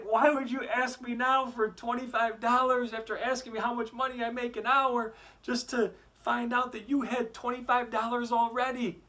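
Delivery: a father flabbergasted and fed up; exasperated dad. Acted. Dad confronting his son about the hidden money, exasperated.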